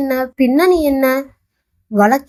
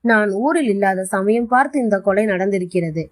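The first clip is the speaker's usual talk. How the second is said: usual speaking voice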